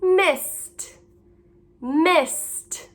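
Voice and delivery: "Missed" with ED as a released t; audible t ending. Shown as a demonstration. Teacher modelling the word list, "Missed" ending in crisp t. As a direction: say "Missed" alone, s into a separate t. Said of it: "Do you hear the t?".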